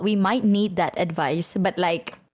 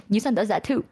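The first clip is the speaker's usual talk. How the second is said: normal talking voice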